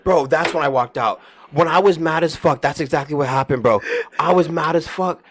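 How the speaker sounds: high, loud, agitated interjection; worked-up outburst. Real, not acted. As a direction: burst in, all fired up by the memory. Bursts in, worked up over memory.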